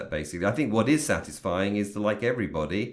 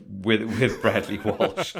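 laughing; slower than usual. Real, not acted. Laughing at the joke.

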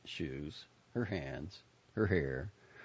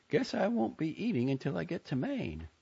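higher-pitched Joni, lightly put out; grumbling young woman; 12 semitones higher than usual. A narrator's reading. Joni to Buddy about her money, grumbling. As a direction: complain lightly in a raised character voice.

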